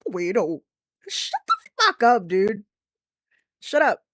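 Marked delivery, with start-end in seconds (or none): 1.1-4.0 yelling. Real, not acted. Streamer yelling at a game character, loud shout. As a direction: yell it at the screen.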